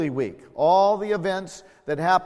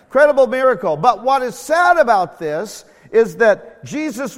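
louder preaching that builds; fiery preaching; 4 semitones higher, 6 dB louder than usual. Acted. Preacher building to the miracle, loud and fervent. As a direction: drive the story home to the church.

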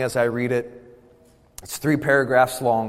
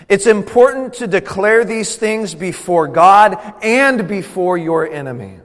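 raised, high and loud preaching; forceful exhortation; 7 semitones higher, 7 dB louder than usual. Real, not acted. Preacher tells congregation to declare before God, loud and emphatic. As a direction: ring it out to the whole room.

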